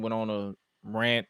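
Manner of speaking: ordinary talk, nothing marked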